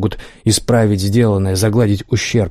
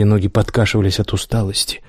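ordinary delivery, nothing marked

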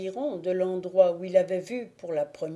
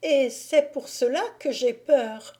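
put-on higher, louder, fearful young woman; frightened cry; 6 semitones higher, 4 dB louder than usual. Agnolette cries out in fear at Thibaut, higher. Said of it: "s'écria la jeune femme".

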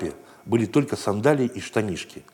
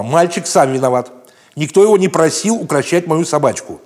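loud, higher scolding tone; indignant lady; 6 semitones higher, 6 dB louder than usual. Acted. Dog's owner blaming the boy before the guard, loud and indignant. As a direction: lay the blame on the boy haughtily. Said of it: "сказала дама".

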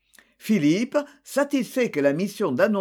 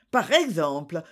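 ordinary delivery

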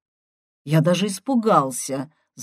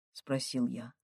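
ordinary delivery

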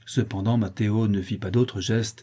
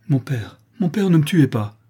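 put-on high, loud child's plea; frightened begging; 6 semitones higher, 6 dB louder than usual. A narrator's reading. Child begging his father for his life, frightened. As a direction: beg desperately, voice raised.

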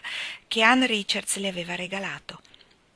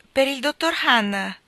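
slow, higher and louder call; messenger announcing; 6 semitones higher, 6 dB louder than usual. A narrator's reading. Office messenger announcing a phone call, formal call. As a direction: call out the name like a porter. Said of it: "annunciò il fattorino".

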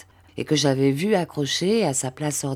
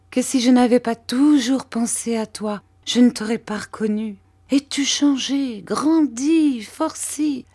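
a hint of a tender peasant mother, higher; lightly voiced old mother; 7 semitones higher, 4 dB louder than usual. A narrator's reading. Mère Barberin fondly marvels at Rémi, lightly voiced. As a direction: marvel fondly at the grown boy.

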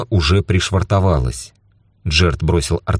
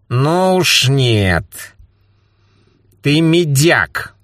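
slow teasing refusal; 8 semitones higher, much slower than usual. Acted.

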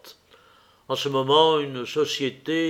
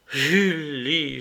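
crying out with a sigh. Acted. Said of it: "m'écriai-je en soupirant".

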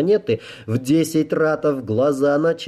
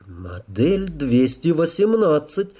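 slow, thoughtful musing, pitched up; pensive; 5 semitones higher, slower than usual. A narrator's reading. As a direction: think aloud, drawing the words out. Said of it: "задумчиво произнёс офицер".

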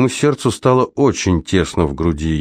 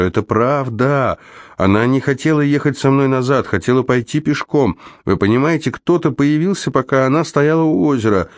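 higher-pitched, insistent protest of innocence; agitated pleading; 5 semitones higher than usual. Acted. Accused Jonas pleads his version to detective Sejer, agitated. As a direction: press the alibi urgently, voice pitched up.

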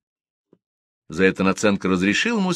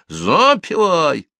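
shouting drill sergeant; 9 semitones higher, 5 dB louder, much slower than usual. Acted. Starshina repeats song order.

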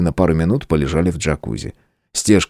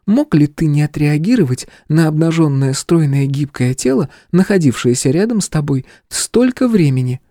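put-on teasing young woman; 9 semitones higher than usual. Princess teases him.

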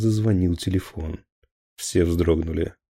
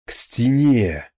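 slow, drawn-out roar, louder and higher; roaring order; 4 semitones higher, 5 dB louder, slower than usual. Acted. Captain roars at officers to face the wall. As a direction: bellow it at the officers. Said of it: "проревел Хонинов".

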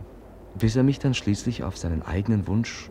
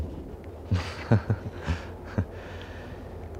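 soft embarrassed laugh; 6 dB quieter than usual. Acted. Patient laughs at his own illness.

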